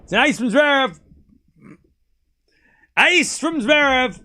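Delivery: excited call. Real, not acted. Calling Zverev's ace for chat, excited and loud.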